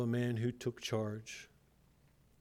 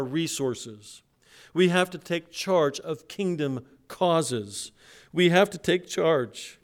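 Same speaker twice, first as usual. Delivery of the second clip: rising preacher's exhortation, louder; preaching; 5 semitones higher, 8 dB louder than usual. Acted. Pastor urging his church, building preacher's call. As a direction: press the congregation to take charge.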